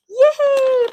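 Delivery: loud, high happy cheer; excited cheer. Real, not acted. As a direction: squeal with delight. Delighted with popcorn gift.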